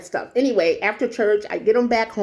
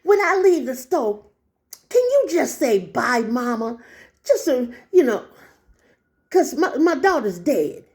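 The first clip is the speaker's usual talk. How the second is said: put-on higher-pitched pleading old lady; imitated elderly woman; 4 semitones higher than usual. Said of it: "this is how she talked".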